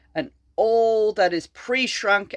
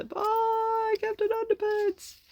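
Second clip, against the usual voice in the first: much higher, sing-song farewell; sing-song goodbye; 4 dB quieter, slower than usual. Real, not acted. Reading to a child, waving the hero off in sing-song. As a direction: chirp a fond goodbye.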